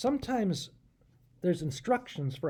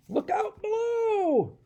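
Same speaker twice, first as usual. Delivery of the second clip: high, slow call from far above; 4 dB louder, slower than usual. Acted. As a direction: warn everyone below. Sam calling down from above.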